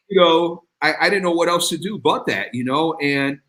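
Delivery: usual speaking voice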